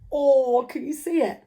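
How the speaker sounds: ordinary delivery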